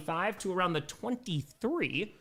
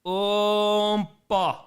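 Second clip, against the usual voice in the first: Italian accent un po'; 5 semitones higher, 6 dB louder than usual. Shown as a demonstration. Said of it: "Tu parli italiano?".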